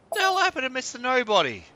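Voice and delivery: high, fast shocked outburst. Real, not acted. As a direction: blurt it out in shock. Shocked outburst at game event.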